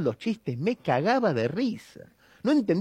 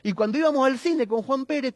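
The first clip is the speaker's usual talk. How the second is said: normal talking voice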